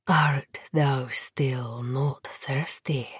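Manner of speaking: low breathed seduction; breathy whisper. Acted. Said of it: "he breathed".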